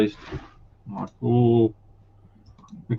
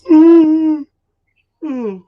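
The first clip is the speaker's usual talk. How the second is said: yawning through a hum; 8 dB louder than usual. Real, not acted. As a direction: let the hum stretch into a yawn. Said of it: "Stop yawning".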